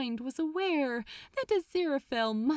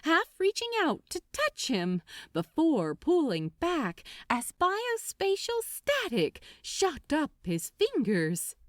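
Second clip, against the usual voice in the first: ordinary delivery, nothing marked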